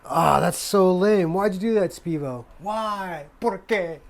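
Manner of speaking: high, loud playful whine; loud, high mock complaint at Spivo; mock outrage. Real, not acted. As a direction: complain to the company like a jilted fan. Complaining to the brand.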